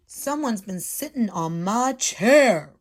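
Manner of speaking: the big papa bear booms; big bear roar; roaring Daddy Bear. Acted. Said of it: "roared Daddy Bear".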